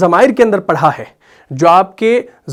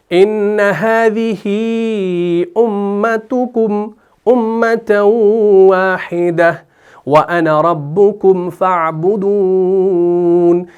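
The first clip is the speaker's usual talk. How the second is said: slow, drawn-out melodic tilawat; Quran recitation; much slower than usual. Shown as a demonstration. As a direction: chant the verse long and measured. Recites Surah Anbiya verse.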